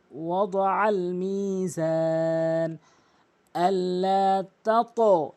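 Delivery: slow, drawn-out chanted recitation; Quran recitation. Shown as a demonstration. Tajweed teacher reciting the verse for students, slow and melodic. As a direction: recite it melodically, stretching every vowel.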